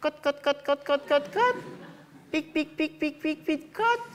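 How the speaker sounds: very high squeaky hen clucks; clucking chicken. Acted. Chicken performing on shelf.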